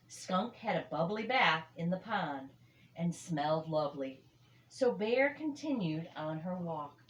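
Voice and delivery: ordinary delivery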